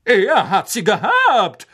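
slow, proud, with a laugh; proudly. A narrator's reading. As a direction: boast it, pleased with yourself. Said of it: "sagte Sir Cadogan stolz".

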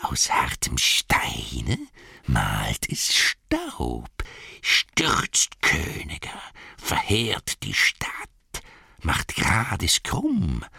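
slow, breathless creature whisper; Gollum's whispered riddle. Acted. (langsames, heiseres Gollum-Flüstern)

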